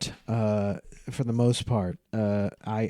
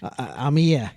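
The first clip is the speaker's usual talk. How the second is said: big Aussie, high then settling; mock-Aussie film character; put-on Australian accent; 5 semitones higher, 4 dB louder than usual. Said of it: "You've obviously absorbed this movie quite well".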